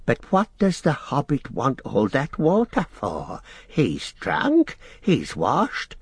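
high, puzzled creature voice; Gollum, querulous. A narrator's reading. Gollum asks Sam about the water, high querulous creature voice. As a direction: question it like a suspicious little creature.

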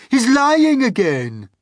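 high, loud, drawn-out accusation; shriek. A narrator's reading. Earthworm accuses the Centipede of lying, shrieking. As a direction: shriek in outrage. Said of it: "shrieked the Earthworm".

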